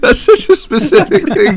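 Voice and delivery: can't stop laughing as you say it; delighted laugh at how specific it is. Real, not acted. Cracking up at friend's confession.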